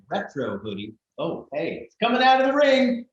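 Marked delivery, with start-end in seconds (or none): 1.8-3.1 much higher and louder, a cheer; excited cheering. Real, not acted. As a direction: cheer it on with a shout. Cheering as the hoodie appears.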